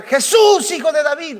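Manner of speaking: high, loud calling out to Jesus; crying out. Retold or imitated. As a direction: shout it high across a crowd. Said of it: "empieza a gritar".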